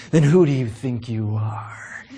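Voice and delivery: low, slow, sneering demand; scornful challenge. Shown as a demonstration. Priests challenging John the Baptist, low and scornful. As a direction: dare him to justify himself, low and slow. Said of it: "Or you could use the voice again".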